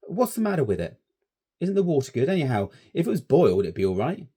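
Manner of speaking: Stanley brushing off the warning; brash schoolboy. A narrator's reading. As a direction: scoff at the danger. Stanley voiced.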